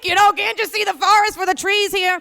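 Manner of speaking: normal talking voice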